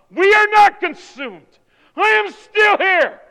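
triumphant declaration. Acted. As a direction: swell into loud survivor's triumph. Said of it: "all of a sudden he says".

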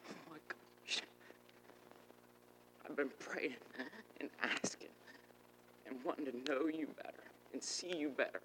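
soft, breath-filled awe; stunned hushed prayer. Retold or imitated. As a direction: whisper it to God, overwhelmed. Acts out stunned prayer to God.

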